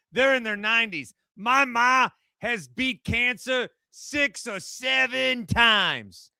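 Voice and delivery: put-on New York accent. Mock New York Jets caller.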